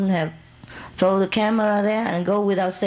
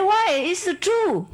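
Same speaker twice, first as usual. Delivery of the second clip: innocent friend; 11 semitones higher, slower than usual. Retold or imitated. Friend's reply, acted high.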